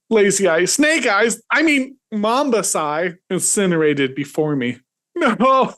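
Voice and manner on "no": high anguished cry of no. Acted. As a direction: cry out in grief. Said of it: "I cried".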